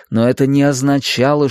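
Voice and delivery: plain reading voice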